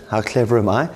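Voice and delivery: normal talking voice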